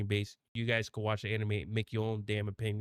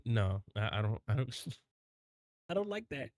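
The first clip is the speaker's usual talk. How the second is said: normal talking voice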